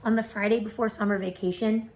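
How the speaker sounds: ordinary delivery, nothing marked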